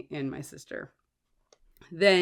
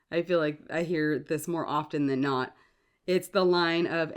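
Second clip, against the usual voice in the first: normal talking voice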